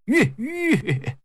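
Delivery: high, drawn-out reining-in call; whoa to horse. Acted. Rider stops fighting and reins in, calling whoa.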